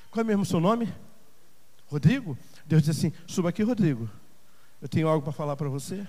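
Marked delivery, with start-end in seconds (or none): 3.3-6.0 low, soft voice of God; hushed, deep invitation; gentle personal call. Acted. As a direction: speak intimately to one listener, quietly. God calls a member by name.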